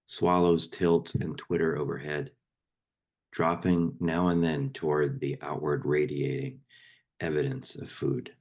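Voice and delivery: poem read aloud in measured, fuller voice; firmly projected poetry reading; poem recitation. Acted. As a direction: recite it line by line, letting phrases fall at the ends. Recites a poem to a meditation group.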